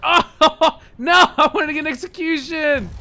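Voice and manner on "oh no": normal talking voice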